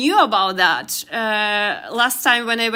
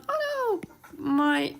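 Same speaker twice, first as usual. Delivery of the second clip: surprised; 6 semitones higher, 5 dB quieter, slower than usual. Real, not acted. Something goes wrong on screen.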